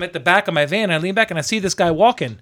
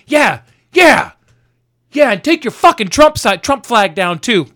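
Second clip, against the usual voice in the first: high, loud imitation of an angry stranger; mad yelling; 7 semitones higher, 5 dB louder, slower than usual. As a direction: yell it across the street like a man mad at everyone. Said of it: "this guy was just mad against the world".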